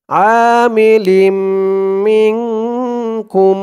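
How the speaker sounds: আমিলিম মিনকুম with the মিম held and hummed through the nose; nasal ghunna demo. Shown as a demonstration. Tajweed teacher showing idgham ghunna to students. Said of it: "ইদগামে বাগুন্না".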